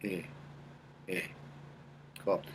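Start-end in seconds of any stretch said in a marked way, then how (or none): 0.0-1.2 short buzzing eh placed in the lower nose; lower nasal eh. Shown as a demonstration. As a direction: tap out little nasal ehs. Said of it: "make a little sound of a".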